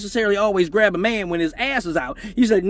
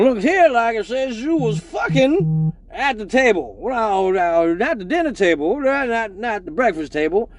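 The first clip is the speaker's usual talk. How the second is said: Judge Joe Brown; 3 semitones higher than usual. Retold or imitated. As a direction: read the charge like a stern, unimpressed judge. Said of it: "went immediately into Judge Joe Brown".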